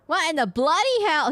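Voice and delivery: a hammed-up British "bloody hell"; British accent. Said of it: "He's British".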